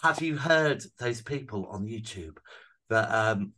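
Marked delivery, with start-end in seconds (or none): none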